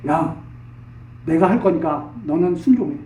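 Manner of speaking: low, quiet fatherly command; Father quietly ordering the Son to obey; God commanding his son. Retold or imitated. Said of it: "하나님께서, 아, 그 아들에게".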